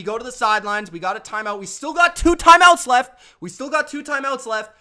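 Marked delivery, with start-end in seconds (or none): 1.6-3.2 loud, higher shout of the play call; pumped-up shout to the huddle; sideline shout. Retold or imitated. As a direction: bark it like a coach on the sideline. Mock coach yelling on sideline.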